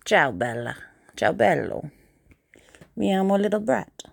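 mock-Italian self-introduction; Italian accent. Shown as a demonstration. Playing an Italian introducing themselves, Italian accent.

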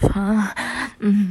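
ordinary talk

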